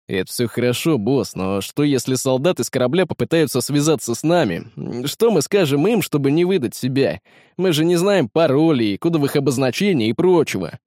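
plain reading voice

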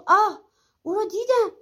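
high, startled exclamation; narrated shriek, kept moderate; startled cry. A narrator's reading. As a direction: cry out as if recoiling. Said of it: "بعد جیغی کشید و عقب پرید و گفت".

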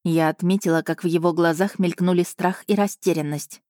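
ordinary delivery, nothing marked

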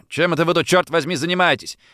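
somewhat theatrical. A narrator's reading. Man confronting the group in the garden, somewhat theatrical. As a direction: demand it with a touch of theatre. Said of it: "в несколько театральной манере".

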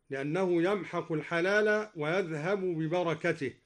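usual speaking voice

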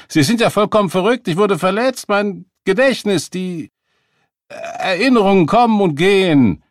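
high, loud, faltering outrage; outraged protest. A narrator's reading. Beggar accused of faking his injury, outraged protest. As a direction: burst out fast and high, then grope for the words.

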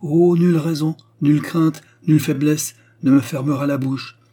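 high, loud impassioned protest; indignant defiance. A narrator's reading. Queen defies king and cardinal.

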